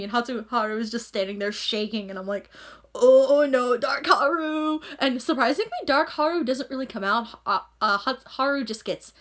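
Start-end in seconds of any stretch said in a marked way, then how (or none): 2.9-4.8 high, drawn-out comic alarm; loud opening into a long mock cry; mock dread. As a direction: wail it like impending doom, playfully. Reacting to Haru shaking with rage.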